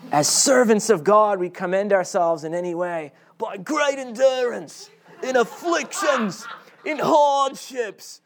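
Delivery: high, hammed-up Aussie advert shout; mock Aussie accent. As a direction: belt it out in a put-on Aussie accent. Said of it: "I can't do an Aussie accent".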